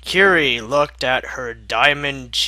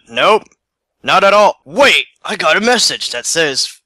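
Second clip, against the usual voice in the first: loud alarm; 5 semitones higher, 9 dB louder, faster than usual. Acted. Urgent codec exchange.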